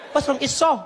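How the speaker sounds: high, loud sarcastic questioning; mocking clerk. Clerk mocking the comedian about his surgery, loud sarcasm. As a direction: needle him with each question.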